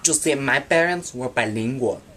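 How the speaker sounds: ordinary talk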